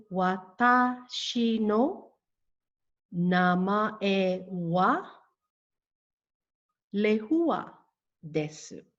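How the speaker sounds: very slow, word-by-word language model sentence; broken down. Shown as a demonstration. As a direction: spell it out slowly for learners, pausing between phrases. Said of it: "Let me break this down for you".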